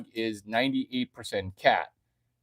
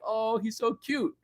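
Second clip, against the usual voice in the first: cooing fan; 7 semitones higher than usual. Retold or imitated. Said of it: "he's like".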